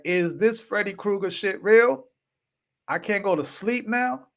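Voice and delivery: high, panicky disbelief; retold fear. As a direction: sound spooked and rattled. Retelling his own terrified reaction.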